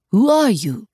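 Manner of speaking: put-on French-accented challenge, rising pitch; testy Frenchman's question; challenging French accent. A narrator's reading. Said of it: "looked up challengingly at Barry".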